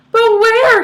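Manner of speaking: high, loud panicked shriek. Acted. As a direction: shriek it wildly. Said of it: "shrieked Missus Salt".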